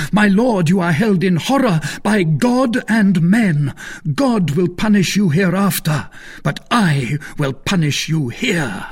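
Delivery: loud, high-pitched denunciation; increasingly excited. A narrator's reading. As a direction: denounce him with mounting zeal. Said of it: "becoming more excited as he spoke".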